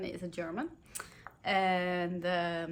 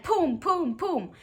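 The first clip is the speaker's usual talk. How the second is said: punchy, higher poom poom cannon sounds; cannon booms; 9 semitones higher, 7 dB louder, much slower than usual. Acted. Reading the gingerbread cannon fire aloud, playful cannon-shot pooms.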